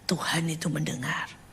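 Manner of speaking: low, hushed, moved voice; soft imitation of someone overcome; retold tearful relief. Friend receiving the cheesecake, hushed imitated tearful relief. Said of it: "Dia nangis, dia bilang".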